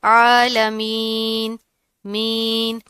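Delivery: عالمین with long drawn ee, slow; stretched yaa madda. Shown as a demonstration. Teacher shows students long vowel in عالمین. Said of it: "تھوڑا سا کھینچ کے ادا کرتے ہیں".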